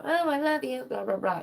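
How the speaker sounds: high, sing-song mock adoration; mocking gush. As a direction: parody an over-sweet fan. Mocking a gushing fan message.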